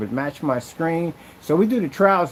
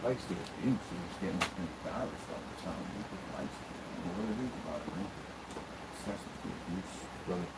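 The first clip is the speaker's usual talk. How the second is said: muttered aside; 7 semitones lower, 17 dB quieter than usual. Real, not acted. Muttering aside about the critic.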